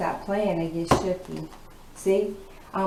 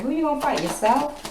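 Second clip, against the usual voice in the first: mocking taunt; 8 semitones higher than usual. Taunting the masculine.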